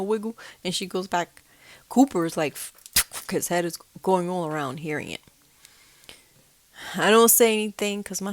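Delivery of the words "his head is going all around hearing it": usual speaking voice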